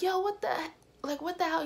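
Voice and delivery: high, indignant mock outburst; boyfriend going off. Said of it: "He was going off".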